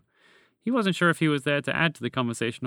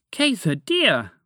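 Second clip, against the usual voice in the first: panicked exclamation; 6 semitones higher, 5 dB louder, slower than usual. Acted.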